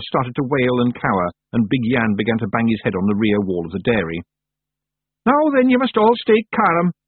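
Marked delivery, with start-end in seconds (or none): 5.2-6.9 high, loud Feagle yell. A narrator's reading. Rob tries to calm his panicking brothers, yelling. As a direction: shout frantically over a panicking crowd. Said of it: "yelled Rob Anybody".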